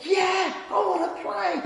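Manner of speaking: high-pitched eager yes; delighted child. Retold or imitated. Shy child invited into the game, delighted high acceptance. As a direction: imitate a thrilled kid accepting. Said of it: "the grin would be there".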